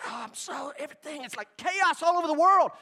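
high, frantic worried voice; mock panicked worrier. Preacher mimicking an anxious listener, mock panic. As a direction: flail like the news scares you. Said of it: "and you're like".

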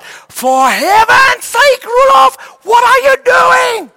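high, slow, full-voiced scolding yell; imitated exasperated mother at full volume; mother's shout. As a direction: shout the child down, stretching each word. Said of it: "she would shout".

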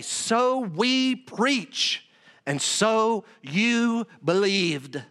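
very slow, each word weighted; slow, deliberate scripture proclamation; drawn-out emphatic reading. Acted. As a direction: stretch out every word of the verse. Preacher hammering home a verse.

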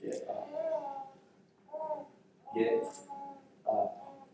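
soft, drawn-out command with long pauses; hushed command. Retold or imitated. Jesus to the dead boy, hushed command. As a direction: quietly summon someone to rise. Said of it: "and he says".

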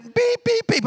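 high mock beeping of the lane warning; high-pitched imitation car beeps; imitated car beeps. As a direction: beep like the car's warning chime.

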